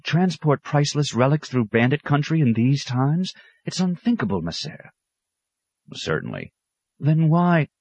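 a worried aide protesting; anxious clerk's voice. Acted. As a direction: clerk protesting to his superior. Clerk Claret speaking.

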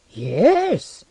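high-pitched surprised yes; mild surprise. A narrator's reading. Alchemist asked about a poet, mildly surprised. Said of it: "said with some surprise".

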